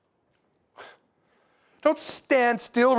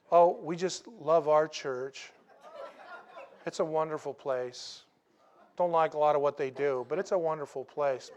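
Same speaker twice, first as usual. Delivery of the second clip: mock sweet complaining; 7 semitones lower, 7 dB quieter than usual. Mocking a complaining church member.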